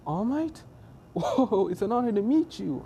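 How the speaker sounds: high, awed excitement; starstruck shock. Acted. Deku meeting All Might, starstruck and high-pitched. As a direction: gush at meeting your hero. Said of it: "looks at All Might in shock".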